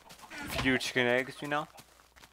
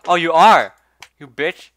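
startled loud yell; 4 semitones higher, 14 dB louder than usual. Real, not acted. Mob attacks after being freed.

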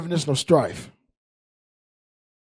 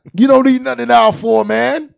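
loud call-out; 6 semitones higher, 8 dB louder than usual. Real, not acted. Calling out the man in the aisle.

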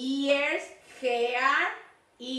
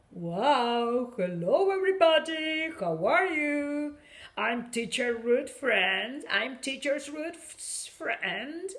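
playful monster voice; slower than usual. Pretend. Monster toy greets the class.

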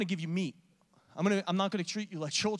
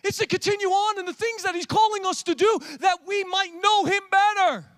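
loud, high, driving preacher cry; full-force preaching; 11 semitones higher, 10 dB louder than usual. Acted. Pastor urging the congregation forward, loud preaching. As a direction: preach it out to the back row.

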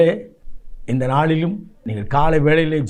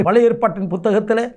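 ordinary talk, nothing marked

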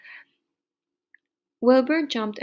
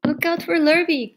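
high, loud warning call; 7 semitones higher, 7 dB louder than usual. A narrator's reading. Cows warn Wilbur of Lurvy, loud call. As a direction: holler a warning to him. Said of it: "called the cows".

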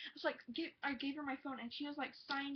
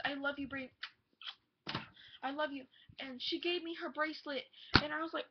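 ordinary talk, nothing marked

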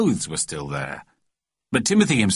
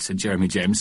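plain reading voice

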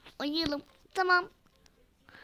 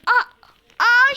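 sharp cry, much higher and louder; startled cry; 8 semitones higher, 13 dB louder than usual. Acted. Doll yelps when her hair gets pulled, a startled cry. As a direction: yelp as your hair is pulled.

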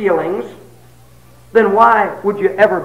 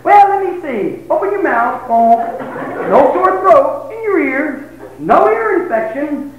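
higher, slow mock doctor voice; doctor examining patient, lightly voiced; 7 semitones higher than usual. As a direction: check him over like a doctor.

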